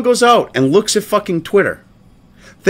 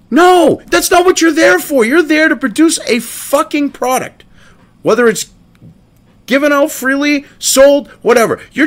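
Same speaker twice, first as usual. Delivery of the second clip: angry outburst; 8 semitones higher than usual. Real, not acted. Angry rant at Tor statement.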